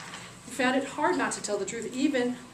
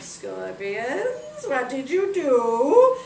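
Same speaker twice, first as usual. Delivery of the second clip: slow, drawn-out accusing call, building loud; 2 semitones higher, 6 dB louder, much slower than usual. Acted. Isis confronting her scorpions, drawn-out accusation. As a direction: stretch the question out, rising to loud.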